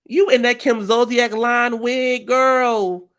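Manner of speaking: slow high loud shade; slow loud roast. Real, not acted. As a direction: drag her slowly and loudly. Roasting Karen's wig.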